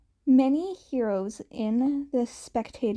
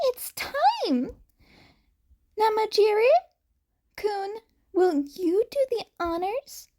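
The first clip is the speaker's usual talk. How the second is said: much higher, gleeful announcer voice; gleeful Nezu voice; 10 semitones higher than usual. Acted. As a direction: announce it with eager glee. Nezu announces exam's final phase.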